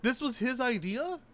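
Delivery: high, stammering incredulity; splutter, lost for words; real stammering shock; stunned disbelief. Real, not acted. Stunned by the shirt.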